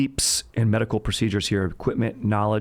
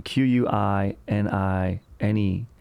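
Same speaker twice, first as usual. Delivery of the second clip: ordinary delivery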